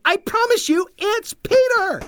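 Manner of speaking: put-on very high-pitched girlish insisting; stubborn insistence. A narrator's reading. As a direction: insist like a foot-stomping girl. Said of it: "stomping her foot".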